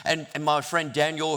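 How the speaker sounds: ordinary delivery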